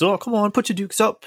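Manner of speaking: high mock-British, cheery and daft; Mister Knight's bad British accent. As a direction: ham up a terrible British accent. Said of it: "he talks like this".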